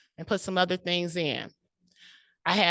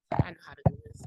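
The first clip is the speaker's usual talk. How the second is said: whisper; 13 semitones higher, 3 dB quieter, much faster than usual. Acted. Whispered self-talk on stage.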